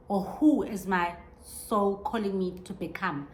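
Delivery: ordinary talk, nothing marked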